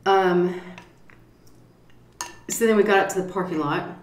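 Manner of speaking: ordinary talk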